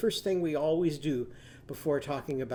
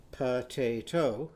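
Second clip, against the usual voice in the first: "potato" slowly in three beats; syllable counting; 3 semitones lower, much slower than usual. Shown as a demonstration. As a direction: clap out "potato". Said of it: "three syllables".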